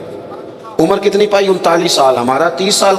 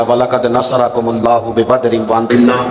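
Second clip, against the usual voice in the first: lower, reverent intoned verse; Quran recitation; 4 semitones lower than usual. Acted. Preacher quoting the Badr verse, lower reverent recitation.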